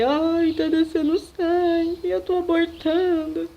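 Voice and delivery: high, slow, teary cry of alarm; panicked plea. Retold or imitated. To the nurse, a high slow panicked wail. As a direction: beg for help as if losing the babies.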